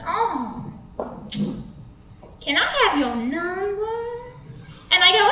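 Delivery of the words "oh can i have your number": lightly voiced creep, a little higher; lilting creepy pickup line; creepy Devon. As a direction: ask it like a slow, swaggering flirt. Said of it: "And he goes".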